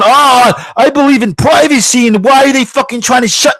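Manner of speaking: high, hammed-up indignation; mock outraged protest. Host parodying that privacy guy, mock outrage. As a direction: imitate a privacy whiner, pitch way up. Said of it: "Don't be that fucking guy going".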